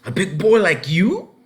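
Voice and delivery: put-on can't believe a boy that big can't ride; surprised disbelief. A narrator's reading. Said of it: "cried the man in surprise".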